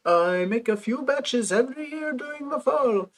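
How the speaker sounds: a hint of French on a cheerful sales pitch; mild French accent. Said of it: "Do I do a French accent".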